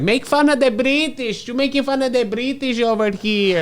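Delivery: mock angry woman. Mimicking the heckling woman at his show, mock shrill. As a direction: imitate the yelling audience member. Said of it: "She's like".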